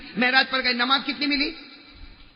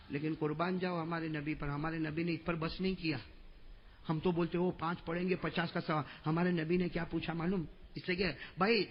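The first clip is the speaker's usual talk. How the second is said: much softer and lower, intimate; almost confiding to the crowd; soft sermon voice; 4 semitones lower, 6 dB quieter than usual. Acted. Preacher explaining gently to listeners, soft.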